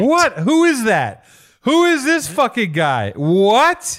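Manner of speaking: who on earth is that, stunned; shocked disbelief. Real, not acted. Shocked at old photo.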